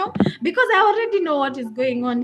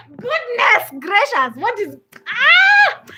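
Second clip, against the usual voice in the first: loud, high, drawn-out exclamation; slow shocked cry ending in a scream; exasperated outburst; 9 semitones higher, 8 dB louder, slower than usual. Retold or imitated. Outburst on hearing the woman's introduction.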